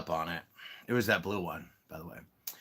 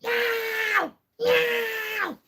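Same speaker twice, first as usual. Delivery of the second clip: high, shrieked meows; imitating cat meow; 4 dB louder than usual. Her cat waking her with loud high mock meows. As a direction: yowl like the cat. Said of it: "to the cat right here".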